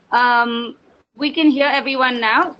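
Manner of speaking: normal talking voice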